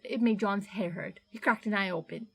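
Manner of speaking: ordinary delivery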